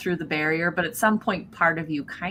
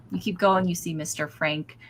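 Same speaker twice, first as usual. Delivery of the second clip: ordinary talk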